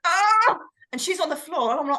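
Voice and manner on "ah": sudden high yelp of fright, much louder; retold scream. Her friend collapsing as the ghost hits, a retold high scream. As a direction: let out a sharp startled shriek. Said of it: "She went".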